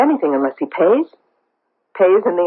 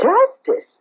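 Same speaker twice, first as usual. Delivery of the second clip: high, sharp disbelieving question; incredulous echo. Acted. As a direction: throw the word back at him in disbelief. Outraged echo of his word.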